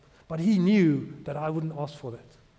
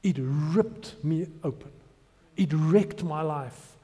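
usual speaking voice

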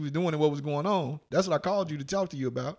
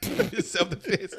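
laughing; 8 semitones higher, much slower than usual. Real, not acted. Laughing through punchline.

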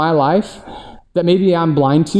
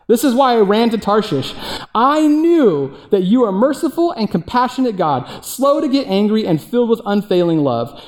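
whiny higher complaint, slowing at the end; complaining Jonah; 4 semitones higher than usual. Retold or imitated. Jonah complaining to God that he knew, higher whiny voice. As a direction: pout through it like a sulky prophet. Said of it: "He complained to the Lord".

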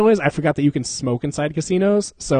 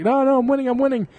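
high, excited insistence; eager protest; 10 semitones higher than usual. Retold or imitated. Begging his friends to stay at the machine, excited. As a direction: plead to keep playing.

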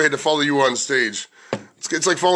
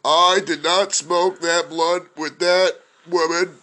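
slow, measured mock denial; Bill Clinton; much slower than usual. Comic spoofing a presidential denial, slow impression. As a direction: stretch it out like a president on the defensive. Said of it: "Bill Clinton impression".

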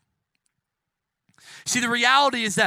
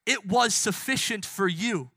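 ordinary talk

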